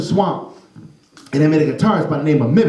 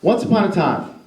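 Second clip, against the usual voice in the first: usual speaking voice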